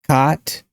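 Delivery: "Caught" as "cot", open unrounded AH, rising; open-jawed AH in "Caught"; cot, AH vowel. Shown as a demonstration. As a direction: say "Caught" like kaht, lifting at the end. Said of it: "repeating four or five times".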